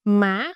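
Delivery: "má" climbing quickly in pitch; "má" in the rising sắc tone. Shown as a demonstration. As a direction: lift "má" sharply at the end. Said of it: "You can hear the raising sound".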